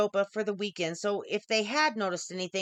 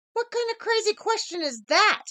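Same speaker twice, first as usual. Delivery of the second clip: much higher, stern mother voice; the mother snaps back, pitched way up; 12 semitones higher, 3 dB louder than usual. A narrator's reading. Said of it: "she asked".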